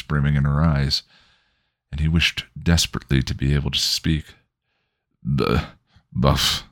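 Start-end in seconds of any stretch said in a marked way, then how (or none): 5.2-6.6 raspy croak through ruined lips. Acted. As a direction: croak the name out painfully. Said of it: "he croaked through ruined lips".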